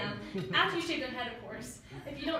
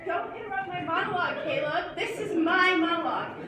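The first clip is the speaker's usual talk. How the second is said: louder, higher irritated telling-off; indignant scolding; 3 semitones higher, 4 dB louder than usual. Acted. Girlfriend scolding Caleb for interrupting her monologue, indignant. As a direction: put the interrupter firmly in his place.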